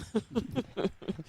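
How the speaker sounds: light laugh at own joke; amused laugh. Real, not acted. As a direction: laugh softly at yourself.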